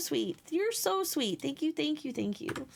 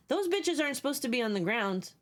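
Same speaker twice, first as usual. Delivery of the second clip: normal talking voice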